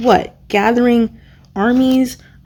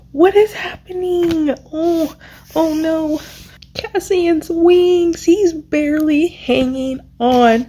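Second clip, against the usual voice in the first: much higher, slow and anguished; distressed disbelief; 8 semitones higher, slower than usual. Real, not acted. Reader panics over Cassian's wings, high anguish. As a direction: let the panic climb as it unfolds. Emotional reaction to death scene.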